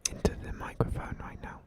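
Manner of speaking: hushed ASMR whisper. Pretend. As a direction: whisper into the mic. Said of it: "A S M R".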